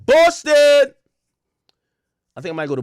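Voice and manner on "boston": high, loud roll-call yell; hype shout. Real, not acted. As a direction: belt the city name out.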